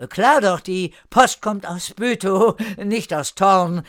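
high, loud insistence; somewhat desperate call. A narrator's reading. Newcomer covers his ignorance before Erika, slightly desperate. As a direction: insist loudly, a touch of desperation. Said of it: "rief er etwas verzweifelt".

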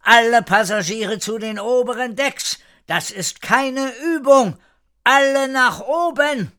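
high, insistent call to passengers; urgent announcement. A narrator's reading. Ship's announcer orders all passengers to the upper decks, urgent high call. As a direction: call out an alarm, pitched well up. Ship loudspeaker evacuation announcement.

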